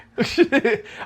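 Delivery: high-pitched burst of laughter; amused laugh. Real, not acted. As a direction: laugh out loud, pitched well up. Joking about leaking insider news.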